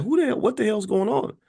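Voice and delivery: high-pitched indignant disbelief; retold angry sputter; livid mock outburst. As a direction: imitate a man fed up and baffled. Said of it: "He got pissed. He was livid".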